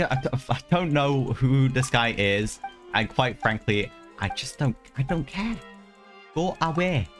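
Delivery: usual speaking voice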